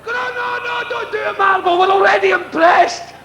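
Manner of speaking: very high shouted begging, hammed-up; panicked pleading. A boy begging Marvel to stop, very high mock panic. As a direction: beg him to stop, near screaming. Child begging tamer again.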